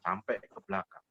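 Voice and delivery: ordinary delivery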